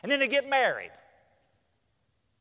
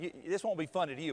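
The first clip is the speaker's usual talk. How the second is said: ordinary talk, nothing marked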